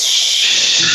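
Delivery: long unvoiced shh; shh exhale. Shown as a demonstration. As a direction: breathe out through teeth. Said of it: "Hear the sound".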